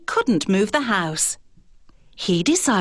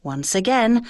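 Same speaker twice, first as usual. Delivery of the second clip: ordinary delivery, nothing marked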